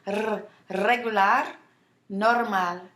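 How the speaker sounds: trilled R alone, then "regular, normal" with rolled R's. Shown as a demonstration. Teacher showing learners her rolling R's in "regular, normal". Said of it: "You hear me rolling my R's?".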